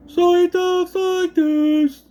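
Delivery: high, drawn-out goofy character voice; Dan Aykroyd. Acted. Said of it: "a goofy voice like".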